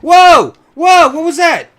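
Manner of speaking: loud, high startled yell; startled shout. Real, not acted. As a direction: yell in sudden shock. Startled by sudden gunfire.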